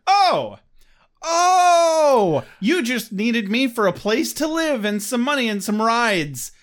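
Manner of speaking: sarcastic oh then breezy goodbye; mock realization. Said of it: "you're like".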